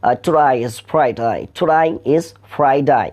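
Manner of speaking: slow Australian "today is Friday" with "today" sounding like "to die"; broad Australian "today is Friday", "day" said as "die"; Australian accent. Shown as a demonstration. Teacher shows students the Australian way, slow Australian accent. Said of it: "অস্ট্রেলিয়ানরা সেখানে বলছে".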